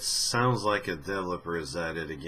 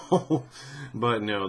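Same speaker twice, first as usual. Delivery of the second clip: ordinary talk, nothing marked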